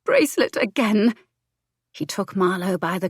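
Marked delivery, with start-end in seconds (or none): 0.0-1.1 shaky, higher through tears; stuttering breath. A narrator's reading. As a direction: catch the breath on it. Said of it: "Her breath stuttered".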